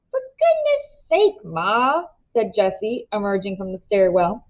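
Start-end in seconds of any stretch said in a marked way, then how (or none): 0.1-2.0 high, drawn-out protest to Ma; teenage son groaning at his mother; exasperated son. A narrator's reading. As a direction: roll the eyes and whine it at Ma.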